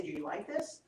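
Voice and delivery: hushed question to the body; whispered to the body. Shown as a demonstration. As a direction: ask it softly, close in. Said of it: "You can whisper to the body".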